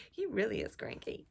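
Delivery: usual speaking voice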